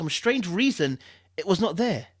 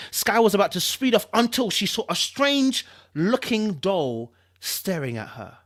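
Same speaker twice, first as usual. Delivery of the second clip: ordinary delivery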